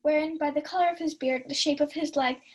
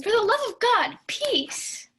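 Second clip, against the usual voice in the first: exasperated shout; 7 semitones higher, 4 dB louder, slower than usual. Acted. Maria hushing the rowdy drinkers.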